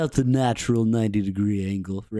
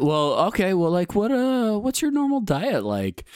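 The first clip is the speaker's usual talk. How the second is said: mock doctor voice; 9 semitones higher than usual. S1 playing the doctor.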